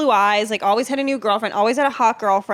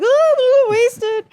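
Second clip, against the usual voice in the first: high, loud mock-tipsy squeal; tipsy mom; 7 dB louder, much faster than usual. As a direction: imitate a mother giddy after one drink. Mom tipsy after one Prosecco.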